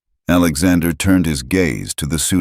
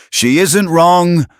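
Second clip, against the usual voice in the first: scream; 12 semitones higher, 5 dB louder than usual. A narrator's reading. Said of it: "He wanted to scream at Travis".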